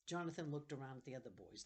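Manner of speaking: ordinary delivery, nothing marked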